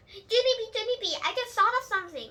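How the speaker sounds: high, loud and quick; eager outburst. A narrator's reading. Lucille telling Junie B her sudden idea, eager and fast. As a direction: rush out the idea.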